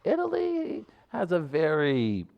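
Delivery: lightly voiced grave historian; a hint of a documentary narrator; mock solemn. Host declaring Italy's history, lightly mock grave.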